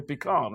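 "pecan" as pih-kahn, open "ah"; Texas pecan pronunciation. Shown as a demonstration. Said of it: "In Texas, they say".

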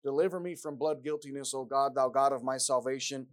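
loud, rising preached psalm; a preacher crying out the psalm; impassioned scripture reading. Acted. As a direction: build the prayer to full force. Reads Psalm 51 aloud.

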